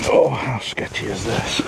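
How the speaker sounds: hushed, tense whisper. Real, not acted. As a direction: breathe it out under your breath. Tricky hooking, whispered aside.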